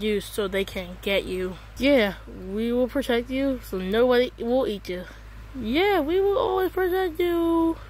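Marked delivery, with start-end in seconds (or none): 5.6-7.8 much higher put-on voice, same promise; silly high voice. Teammate promising protection in a silly high voice. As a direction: pitch the voice way up for a joke. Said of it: "Why does your voice sound like that?".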